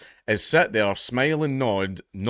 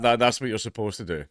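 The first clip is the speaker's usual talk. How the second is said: usual speaking voice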